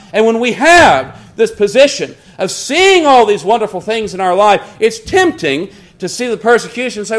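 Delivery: forceful, rising and falling sermon cadence; preaching. Acted. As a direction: preach with conviction, punching key words. Preaches to a congregation.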